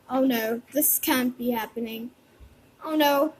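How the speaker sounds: low, hushed pretend despair; quiet dismay. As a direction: say it softly to yourself in dread. Finds daughter unconscious.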